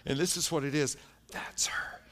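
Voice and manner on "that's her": unvoiced whisper; just a breath, no voice. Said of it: "I hear this whisper".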